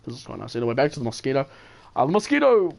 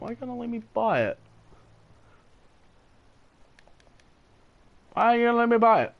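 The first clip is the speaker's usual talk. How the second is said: higher, whining complaint; frustrated whine; 10 semitones higher than usual. Real, not acted. Gamer whines at the game for blocking his purchase. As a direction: plead at the game, whiny and high. Game won't let him buy.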